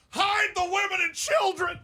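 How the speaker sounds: high, shouting announcer; Brent Musburger call. Retold or imitated. Retelling a huge basketball dunk, shouting like Musburger. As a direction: bellow the call like falling out of a chair. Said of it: "and he's like".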